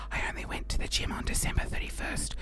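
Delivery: hushed confession; whispering a confession to the listeners; whispered aside. Real, not acted. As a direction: let the secret slip under your breath. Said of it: "confessing aside".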